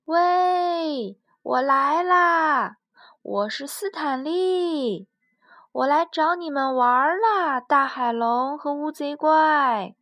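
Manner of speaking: loud, happy calling out; Stanley boldly shouting to the monsters; cheerful shout. Acted. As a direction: call out gleefully into the dark cave. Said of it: "斯坦利笑着喊".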